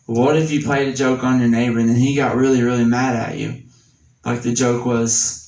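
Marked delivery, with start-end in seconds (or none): none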